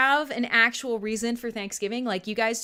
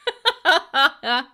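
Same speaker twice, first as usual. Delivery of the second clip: loud teasing burst of laughter; real gleeful laugh; 3 semitones higher, 7 dB louder than usual. Real, not acted. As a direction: laugh hard at your own tease. Laughs after teasing a viewer.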